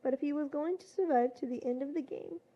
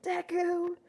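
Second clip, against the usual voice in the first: higher-pitched narrated scream; screamed name; 5 semitones higher, 3 dB louder than usual. A narrator's reading. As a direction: cry out the rival's name, higher.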